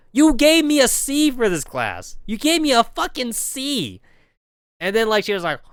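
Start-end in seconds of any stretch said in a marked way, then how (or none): 0.1-4.0 mock outrage. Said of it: "I'm like".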